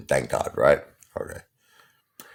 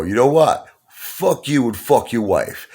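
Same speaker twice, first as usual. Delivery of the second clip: mock tough-guy gangster, louder and higher; gangster talk; 8 semitones higher, 4 dB louder than usual. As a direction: put on a swaggering mob threat, mocking him. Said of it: "talking like that gangster talk".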